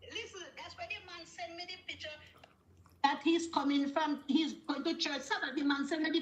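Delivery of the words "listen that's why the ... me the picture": whisper. Real, not acted. Caller lowers voice telling secret.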